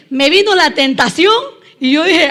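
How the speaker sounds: usual speaking voice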